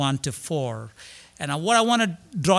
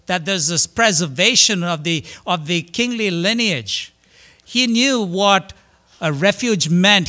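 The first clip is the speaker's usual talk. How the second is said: fiery preaching; 3 semitones higher, 4 dB louder than usual. Acted. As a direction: lift the room, preach it out strong. Preacher raising voice again.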